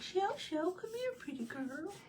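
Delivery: high, cooing imitation of him; imitated pet voice. Imitating John calling the cat, high pet voice. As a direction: mimic a man sweet-talking his cat, way up high. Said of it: "he doesn't talk that way to anybody else".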